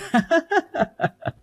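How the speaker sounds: very high teasing cackle; mocking laugh. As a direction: laugh it off at her expense. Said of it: "How could you laugh about it like that?".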